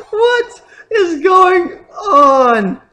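slow, loud, half-laughing cry of disbelief; laughing shout. Real, not acted. Gamer laughing loudly in disbelief at the battle.

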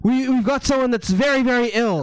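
high urgent shout for blankets and medics; panicked guard raising the alarm; guard yelling. Acted. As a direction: call for help in alarm. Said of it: "one of them yells out".

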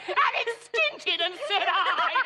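laughing. Real, not acted. Nurse laughing at baby story.

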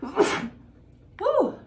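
loud sneeze with a whoop; sneezing. Real, not acted. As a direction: sneeze hard and cry out woo. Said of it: "Wow, I just sneezed".

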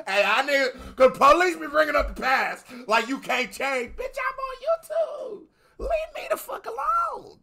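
high, loud, ranting yell; hammed-up outburst, slowed on "you can't change" and "Bitch, I'm on YouTube"; mock shout at police. Reactor pretending to yell at police about his past, loud and high.

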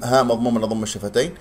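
ordinary talk, nothing marked

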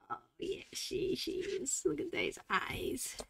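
soft unvoiced whisper, slowing; whispered aside. Real, not acted. Admiring the cat's eyes, a soft whisper. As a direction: hush it as if not to wake her.

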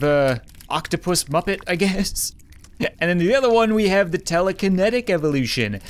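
ordinary delivery

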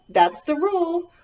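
ordinary delivery, nothing marked

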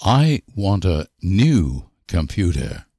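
normal talking voice

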